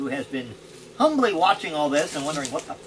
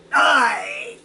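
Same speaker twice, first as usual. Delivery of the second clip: loud, high war shout; battle cry; 4 semitones higher, 5 dB louder than usual. Acted. Cleric swinging his axe at the kobold, shouting. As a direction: hurl the word at the foe.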